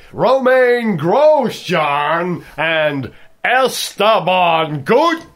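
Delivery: put-on big drawn-out American accent. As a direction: stretch the names in a loud American accent. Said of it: "saying the names of the two Haas drivers in an American accent".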